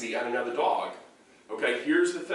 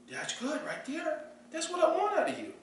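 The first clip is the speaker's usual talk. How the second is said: gentle high praise; soft praise; 6 dB quieter than usual. Shown as a demonstration. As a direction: coo to the dog. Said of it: "That's a good girl".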